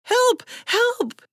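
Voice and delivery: very high cries for help; frightened cry. A narrator's reading. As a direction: call out in alarm, pitch leaping up. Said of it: "Now she was afraid".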